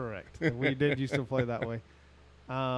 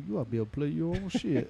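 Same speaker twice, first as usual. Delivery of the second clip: faster, mock Chicago accent; 3 semitones higher, faster than usual. Shown as a demonstration. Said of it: "That sounded like I was from Chicago".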